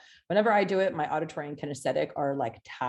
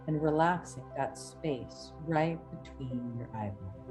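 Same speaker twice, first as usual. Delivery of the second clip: hypnotic induction; 5 dB quieter than usual. Acted. Reads hypnotic induction over music.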